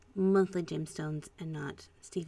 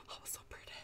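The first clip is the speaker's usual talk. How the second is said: very soft, breath-only whisper of awe; delighted whisper; 19 dB quieter, much faster than usual. Real, not acted. Painter marvelling at flowing paint, delighted whisper. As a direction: whisper it as if awestruck.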